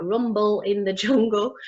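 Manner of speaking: a real laugh at her own stumble; laughing at herself. Real, not acted. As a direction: chuckle mid-phrase as she trips on Jungle. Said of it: "can't say that".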